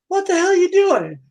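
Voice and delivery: high-pitched, incredulous question; startled protest. Acted. Customer questioning the woman blowing on his face, startled. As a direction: squeal it in bewilderment.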